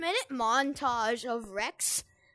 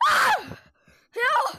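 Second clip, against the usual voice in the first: terrified horror victim; 11 semitones higher, 7 dB louder than usual. Pretend. Scared by the corn monster.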